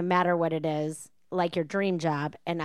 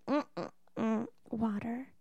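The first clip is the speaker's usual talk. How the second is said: much softer pouty hum into a tiny word; baby talk whimper; 2 semitones higher, 8 dB quieter, much slower than usual. Retold or imitated. The girlfriend asking for water, soft baby whimper. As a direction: whimper it like a shy toddler. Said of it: "And she's like".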